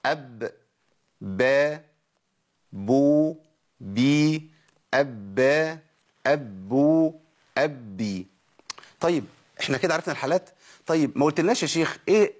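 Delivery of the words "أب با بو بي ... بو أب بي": أب، با، بو، بي said slowly one by one; drill of ب states. Shown as a demonstration. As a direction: repeat each ب form clearly for the class. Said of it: "نجيبها تاني وركزوا معايا".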